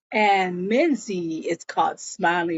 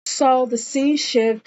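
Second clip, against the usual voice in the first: ordinary talk, nothing marked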